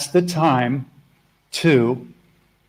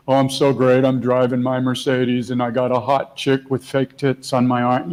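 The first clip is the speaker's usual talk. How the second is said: bragging ego; 3 semitones lower than usual. Retold or imitated. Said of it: "This isn't fake love, like, ego, like".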